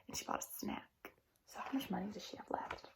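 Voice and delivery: soft conspiratorial whisper. Real, not acted. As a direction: lean in and whisper the question. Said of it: "teacher whispers question to kids".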